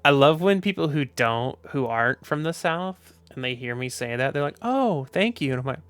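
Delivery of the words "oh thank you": mock-sweet, high-pitched thanks; naively grateful. Outsider thanking a Southerner for a hidden insult, naively grateful. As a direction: imitate someone oblivious and flattered.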